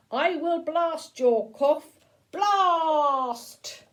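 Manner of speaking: a toy robot firing at the cough. Acted. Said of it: "And the robot said".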